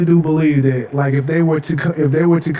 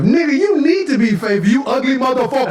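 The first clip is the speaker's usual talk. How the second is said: high, incredulous roast; mock indignation; 7 semitones higher than usual. Viewer roasting man on couples retreat, mock indignation. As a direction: pitch it up and scold him comically.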